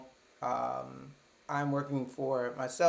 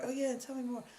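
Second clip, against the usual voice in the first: mock talk show host; 10 semitones higher, 5 dB quieter than usual. Child interviewing stuffed animals.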